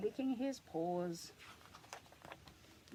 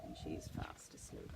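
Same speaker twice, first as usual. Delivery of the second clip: hushed whisper; 8 dB quieter than usual. Shown as a demonstration. Reading to a child, whispering she's asleep. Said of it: "but much quieter".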